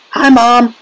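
put-on high mouse-squeak boy; tiny little voice. A narrator's reading. Shrunken boy greets mother from television, tiny squeaky voice. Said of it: "A tiny little voice".

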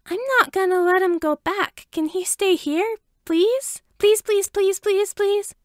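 put-on high, pleading little boy voice; excited kid begging his parents; chirpy begging child. A narrator's reading. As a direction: beg like a five-year-old. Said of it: "Izuku chirped".

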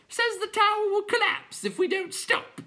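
very high squawky clown; clown creature voice. A narrator's reading. Clown informing the other clowns, very high voice. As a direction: pipe it out in a silly high voice.

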